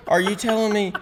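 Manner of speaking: very high, incredulous question; retold disbelief. Buddy learning about the video dog's name, incredulous. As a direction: imitate an astonished buddy.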